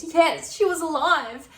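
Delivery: loud, high-pitched mock protest. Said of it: "in a really high-pitched voice".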